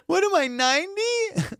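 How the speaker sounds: very high, slow, through laughter; mock indignation. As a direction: imitate her incredulous punchline, drawn out. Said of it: "she kept hitting the joke".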